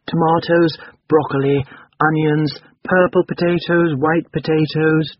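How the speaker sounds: put-on high, slow singsong drone of a child; droning little boy. A narrator's reading. Boy echoing his mother's list of vegetables, droning. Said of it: "droned the little boy".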